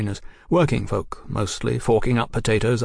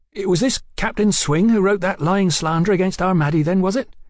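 high, heated questioning; indignant soldier brother; 9 semitones higher, 4 dB louder than usual. Acted. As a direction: demand to know who slandered your sister.

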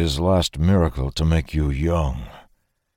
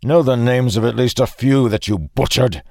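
raised, louder angry demand; heated, higher-pitched reproach; bitter accusation; 7 semitones higher, 5 dB louder than usual. A narrator's reading. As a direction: fling the accusation at a killer.

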